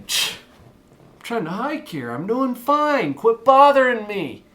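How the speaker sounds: put-on annoyed teen. Teen telling parents to back off, slow irritated voice. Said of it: "you're thinking".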